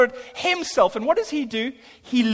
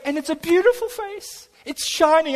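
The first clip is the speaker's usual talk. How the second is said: wonderstruck; 7 semitones higher than usual. Retold or imitated. Marvelling at the woman's face.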